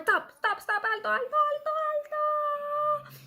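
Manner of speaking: high call of stop and alto. Shown as a demonstration. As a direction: sing out stop like a guide. Teacher calls halt while walking.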